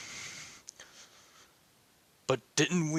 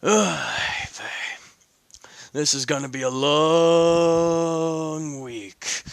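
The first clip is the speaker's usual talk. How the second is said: weary sigh; 3 dB louder, much slower than usual. Acted. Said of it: "Leonidas states while rubbing his forehead".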